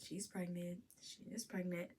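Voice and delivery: quiet, restrained reminder to oneself; inner thought. Retold or imitated. Storyteller reminding herself in her head, hushed inner voice. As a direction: say it softly, as if thinking it. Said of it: "In my head, I'm like".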